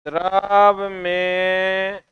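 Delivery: very slow, held-out lead-in; drawn-out prompt. Shown as a demonstration. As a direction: stretch both words, waiting for the class. Stretches words for class to complete. (बहुत खींचकर, जवाब के इंतज़ार में)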